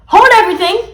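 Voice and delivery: high, loud announcement; bold, excited shout; commanding interruption. A narrator's reading. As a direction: call a halt to the whole room. Hero child halts everyone.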